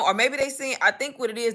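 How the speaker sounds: ordinary delivery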